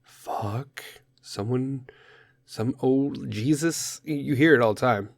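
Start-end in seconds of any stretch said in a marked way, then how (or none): 0.0-4.0 exasperated mutter. Shown as a demonstration. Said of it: "just be like".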